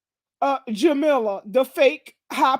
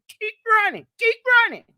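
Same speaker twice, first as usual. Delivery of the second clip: rising taunt; 7 semitones higher, faster than usual. Real, not acted. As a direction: build the taunt up to a yell. Taunting a rival, repeated.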